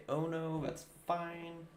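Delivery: ordinary talk, nothing marked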